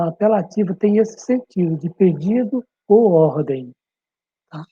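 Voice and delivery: normal talking voice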